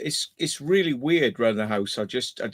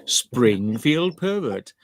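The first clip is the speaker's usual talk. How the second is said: drawn out slowly, word by word; slow, mock-solemn read; slow deliberate reading; much slower than usual. Shown as a demonstration. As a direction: savor each word of the username. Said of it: "do you just want me to say those words".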